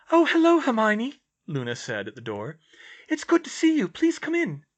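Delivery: very high, loud greeting; serene oddball girl. Acted. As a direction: greet a friend in a high character voice. Voices Luna greeting a visitor.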